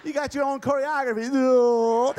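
high, loud puppet voice with gruff no; dummy voice for Jake. Acted. Ventriloquist voicing Jake's dummy, high and loud. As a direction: ventriloquist bit with a stubborn dummy.

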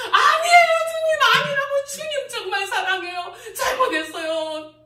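high, loud desperate protest; pleading shout. Retold or imitated. Preacher reenacting her third desperate plea to God, shouting. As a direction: cry out high and loud, begging.

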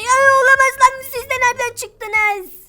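high, loud startled cry; startled alarm. Acted. Arda is ambushed by two robbers, startled. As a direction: yelp in alarm, drawing out the question.

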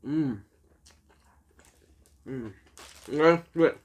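chewing while humming; talking between bites; mouth full. Real, not acted. Seen 0: Bites burger, then chews with puffed cheeks during the "mm" sounds.

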